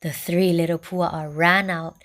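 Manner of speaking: usual speaking voice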